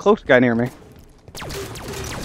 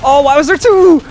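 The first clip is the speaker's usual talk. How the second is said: surprised; 12 semitones higher, 7 dB louder than usual. Real, not acted. Sudden double kill surprise.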